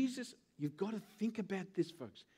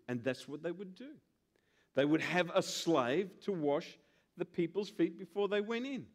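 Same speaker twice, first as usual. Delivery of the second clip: normal talking voice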